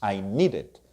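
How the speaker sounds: "need it" run together as "needed", two beats; two-syllable needed. Shown as a demonstration. Teacher repeats past tense for students, two-syllable needed. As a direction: sound out "need" plus an "ed" syllable. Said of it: "Two syllables".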